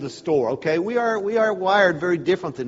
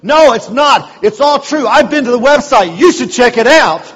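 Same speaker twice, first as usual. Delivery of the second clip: loud, higher, gleeful backing-up; the trainee cheerfully siding with the teller; hammed-up enthusiastic server; eager agreement; 8 semitones higher, 12 dB louder than usual. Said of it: "he looks at me and goes".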